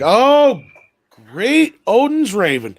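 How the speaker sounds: high, drawn-out near-swear exclamation; loud "oh" that swerves away from a swear word; taken aback. Retold or imitated. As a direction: stretch it out high, catching the curse. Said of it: "she's about to say a swear word".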